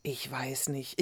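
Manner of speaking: hushed, hesitant near-whisper; unsicher. A narrator's reading. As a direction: answer softly, full of doubt. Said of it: "blickte sich unsicher um". (unsicher, fast geflüstert)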